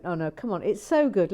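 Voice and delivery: normal talking voice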